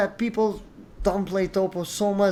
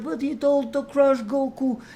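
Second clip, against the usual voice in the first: mocking quote; 8 semitones higher than usual. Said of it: "Some people might be like".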